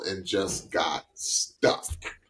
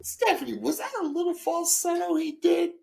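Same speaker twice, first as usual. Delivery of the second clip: playful high voice. Acted. Asking about the falsetto he did.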